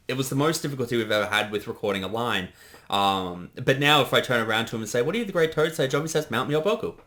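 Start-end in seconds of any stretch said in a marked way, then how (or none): none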